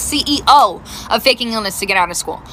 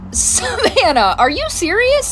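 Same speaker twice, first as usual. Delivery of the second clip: incredulous; 8 semitones higher, slower than usual. Retold or imitated. Mom can't believe daughter.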